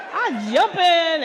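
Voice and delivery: slow laughing I jump in; slow and loud, laughing. Retold or imitated. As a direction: drag it out through laughter.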